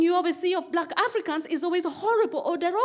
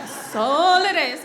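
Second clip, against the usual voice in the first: slow, stretched emphasis on "all"; lingering, savoured "all it is"; drawn out; 3 dB louder, slower than usual. Shown as a demonstration. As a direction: draw out "That's all" long and emphatic. Same line drawn out on repeat.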